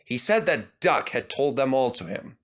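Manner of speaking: plain reading voice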